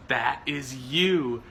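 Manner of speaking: mock her questioning, voice up high; teasing impression of wife; mock imitation. Mimicking wife's tone.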